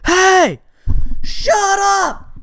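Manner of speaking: high, loud re-enacted yell; yelled out window. Teller yelling out the window at his dad, high shout. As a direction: holler it high, stretching the words. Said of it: "I yell out the window".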